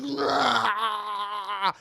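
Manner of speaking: long, drawn-out anguished cry; mock dying wail. Man crushed under a shelf, long mock wail. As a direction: hold one hammed-up agonized yell.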